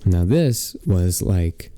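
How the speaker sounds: slow, low, hushed zen voice; mock the calm of a self-care fair. Describing the self-care fair to his friend in a slow mock-zen voice. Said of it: "be all zen, all calm and collected".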